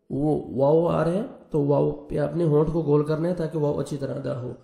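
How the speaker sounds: usual speaking voice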